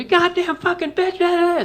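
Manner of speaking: mock screaming dad. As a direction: scream the curse like a maniac dad. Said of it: "screaming and shit".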